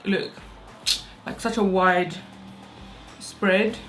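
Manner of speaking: ordinary talk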